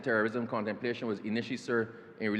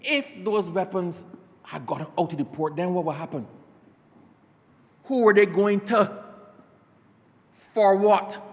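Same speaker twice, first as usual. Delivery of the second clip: reasonable person's doubt; 5 semitones higher, 6 dB louder than usual. Retold or imitated. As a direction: ask it like an alarmed sensible citizen. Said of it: "any logical and reasonable person would say".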